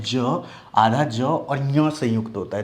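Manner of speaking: ज, आधा ज और ञ spelled out slowly, sound by sound; slow sound breakdown. Shown as a demonstration. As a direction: build ज्ञ slowly from ज and ञ. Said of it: "तो ये ज्यों आएगा". (ज्ञ को धीरे-धीरे ज और ञ में तोड़कर बोलें)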